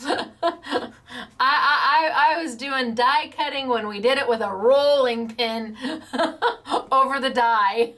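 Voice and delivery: words broken up by real laughter; laughing. Real, not acted. Crafter laughing while recalling her rolling pin die cutting. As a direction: laugh through the memory.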